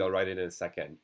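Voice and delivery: ordinary talk, nothing marked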